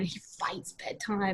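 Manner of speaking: softly whispered aside. Real, not acted. Telling the listener about her son's bedtime, whispered. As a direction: drop to a whisper.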